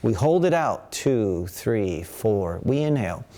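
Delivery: calm instructor counting breaths; guided breathing count. Acted. Teacher guiding students' breathing, calm counting. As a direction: lead the class through the breath, count by count.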